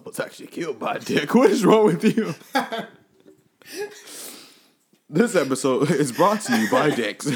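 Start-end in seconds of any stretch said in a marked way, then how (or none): 5.1-7.3 laughing. Real, not acted. Laughing mock sponsor line.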